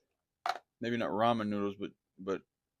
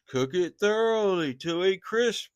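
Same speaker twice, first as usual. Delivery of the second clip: louder, higher and slow, every word pressed; emphatic instruction; 9 semitones higher, 6 dB louder, slower than usual. Real, not acted. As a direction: drive home "crisp" like an order. Cooking advice repeated with emphasis.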